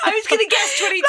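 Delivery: high, fast, laughing complaint; high pitched. Real, not acted. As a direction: squeak it out laughing. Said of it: "That was so high pitched".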